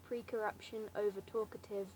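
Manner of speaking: ordinary talk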